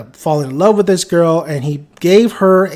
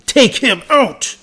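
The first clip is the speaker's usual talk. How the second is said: higher, slow, drawn-out mock gangster order; mock menacing boss; 5 semitones higher, slower than usual. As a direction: stretch the words like a mock-threatening boss. Claude's order, repeated in a voice.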